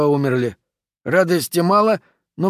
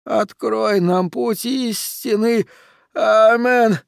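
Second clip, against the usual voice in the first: final cry; 9 semitones higher, much slower than usual. A narrator's reading. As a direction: cry the last words out to heaven. Last cry of the prayer.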